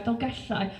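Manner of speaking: "Dolgellau" with the Welsh double L hissed at the side of the tongue; gentle native "Dolgellau"; Welsh pronunciation. Shown as a demonstration. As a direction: say "Dolgellau" softly, the ll breathy and lateral. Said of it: "Sounds gorgeous".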